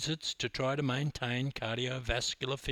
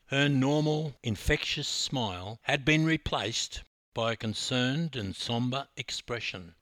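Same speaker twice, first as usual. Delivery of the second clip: ordinary delivery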